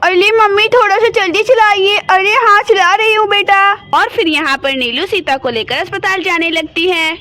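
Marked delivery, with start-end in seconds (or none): 3.9-7.1 lower, even storyteller voice reading the scene; story narration. A narrator's reading. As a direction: narrate the action calmly between the scenes. Narration line. (कहानी सुनाने वाली आवाज़)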